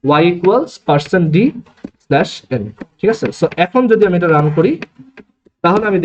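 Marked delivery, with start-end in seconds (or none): 0.0-2.6 slow dictation. Shown as a demonstration. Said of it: "so আমি এখানে লিখবো".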